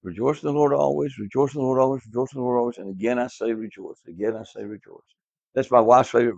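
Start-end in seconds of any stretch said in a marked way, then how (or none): 0.0-5.0 verse chanted for memorizing, fading softer at the end; recitation. Shown as a demonstration. Teacher leading kids to memorize the verse, chanted. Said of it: "let's memorize this real quickly".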